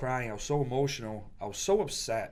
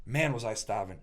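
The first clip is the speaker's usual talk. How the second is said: ordinary talk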